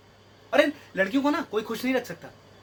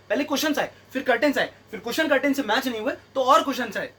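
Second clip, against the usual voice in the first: usual speaking voice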